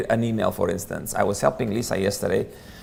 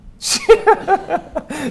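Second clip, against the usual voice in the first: high, loud laugh; a loud, high laugh at the punchline; burst of laughter; 6 dB louder than usual. Real, not acted. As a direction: let out a real laugh. Speaker laughs at own joke.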